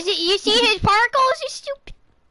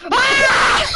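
loud, high startled scream; sudden full-voiced yell; scream for life; 8 semitones higher, 5 dB louder than usual. Real, not acted. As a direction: scream as if fleeing for your life. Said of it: "I instantly scream for my life".

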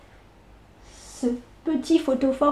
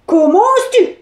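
loud, raised indignation; a furious witch, pitched up; outraged witch; 7 semitones higher, 5 dB louder than usual. Acted. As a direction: scold the insolent boy.

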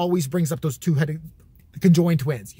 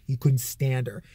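ordinary talk, nothing marked

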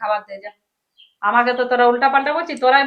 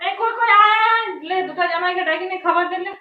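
high-pitched call to come and eat; 6 semitones higher than usual. Acted. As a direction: summon the family over to the meal. Mother calls both sons-in-law to eat.

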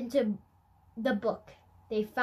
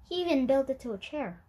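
ordinary delivery

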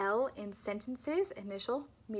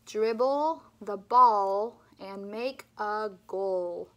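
"Dribble" and "ball" said louder, slowly, with clear L; louder repeat; 8 dB louder, much slower than usual. Shown as a demonstration. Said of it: "Let's do it one more time".

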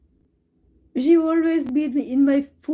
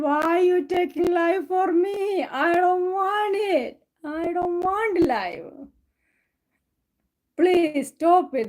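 slow, higher sulky plea; cat's inner voice; 6 semitones higher, much slower than usual. Retold or imitated. Owner voicing her cat's thoughts, slow high plea. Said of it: "She's thinking".

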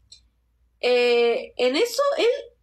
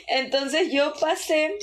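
higher, drawn-out words through a laugh; 7 semitones higher, slower than usual. Real, not acted. Vlogger telling her carpet story, laughing. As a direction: carry a real laugh into the words.

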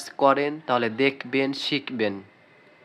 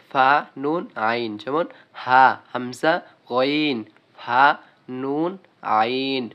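very slow, letters spaced apart; slow drill; much slower than usual. Shown as a demonstration. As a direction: drill each letter slowly for the class. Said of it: "teacher drilling letter names". (ধীরে ধীরে হরফ পড়া)

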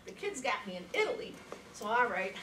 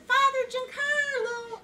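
high, loud, drawn-out call across the church; yelling out; 12 semitones higher, 6 dB louder, slower than usual. Retold or imitated. Searching a crowded church for the priest, calling out loud. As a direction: call the name out long to find him in the crowd. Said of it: "I yell out".

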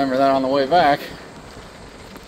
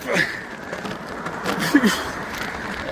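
breathless exhale; 9 semitones higher, 5 dB quieter than usual. Real, not acted. Exhausted at the hilltop.

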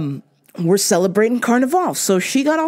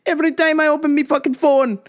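higher and quicker, a touch of Jamaican; building rant; 7 semitones higher, faster than usual. Real, not acted. Host ranting about his phone full of [name] news, building up. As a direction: ramp it up on the repeat. Repeated rant line escalating.

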